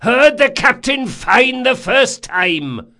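slow, high, loud retort; cried retort. A narrator's reading. Sailing master answering a repeated order, shouted retort. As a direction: holler back at a repeated order. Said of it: "cried Caldris".

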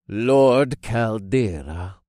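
slow, portentous cult-leader voice; solemn cult leader. A narrator's reading. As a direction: intone the title slowly and grandly. Cult leader answers with his title.

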